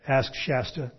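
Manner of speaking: ordinary delivery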